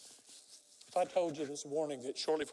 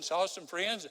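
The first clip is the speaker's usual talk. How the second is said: ordinary talk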